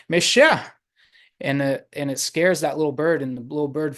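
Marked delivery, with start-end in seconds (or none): 0.1-0.6 raised, higher shout to shoo it off; acted yell. Man shooing away the woodpecker, acted yell. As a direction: shoo the bird with a loud, high yell. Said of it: "he yells as loud as he can".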